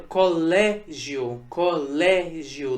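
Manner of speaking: "colégio" devagar, LÉ forte e alongado; tônica na penúltima. Shown as a demonstration. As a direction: destaque o LÉ cada vez em "colégio". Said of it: "foi a penúltima sílaba". ("colégio" silabado, com peso no "lé")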